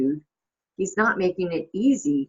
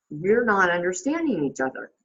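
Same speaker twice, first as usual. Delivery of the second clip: ordinary delivery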